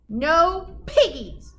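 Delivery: slow, drawn-out mooing; mooed. Acted. Cow lowing its refusal slowly and loud. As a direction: stretch it like a lazy cow. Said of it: "mooed the cow".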